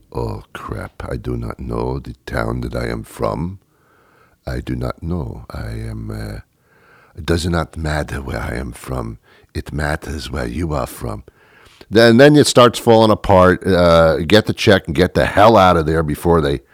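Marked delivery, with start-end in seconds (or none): 0.1-11.2 low, soft fake French accent; hushed, floundering mock-French seducer; phony French accent. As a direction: bluff when cornered, keeping the French accent. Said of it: "you do it with a French accent".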